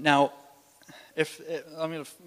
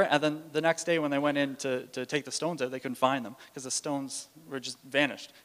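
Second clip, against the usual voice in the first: ordinary talk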